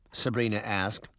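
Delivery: ordinary delivery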